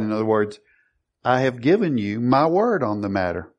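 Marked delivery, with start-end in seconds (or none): none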